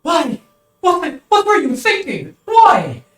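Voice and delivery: high, loud, grief-stricken questioning; anguished plea. Acted. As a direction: cry out over his dying sister. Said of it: "You hear him barely".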